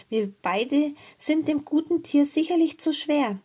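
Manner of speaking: put-on high child's voice explaining; lightly voiced earnest girl; girl objecting. A narrator's reading. Gretel objects to her brother.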